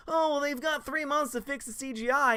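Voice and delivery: high dismissive mock voice; mock excuse. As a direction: parrot the fans' excuse up high. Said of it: "excuse is gonna be like".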